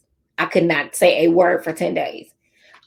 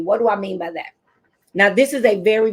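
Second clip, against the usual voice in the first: usual speaking voice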